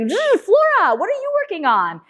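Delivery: high zoom, then a high friendly call; Steve Wozniak. Retold or imitated. Segway rider greeting the next student, high-pitched imitation. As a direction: imitate a keynote speaker zipping up to a student. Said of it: "then he'd be like".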